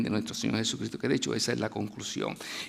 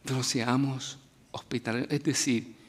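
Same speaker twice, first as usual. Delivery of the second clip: ordinary delivery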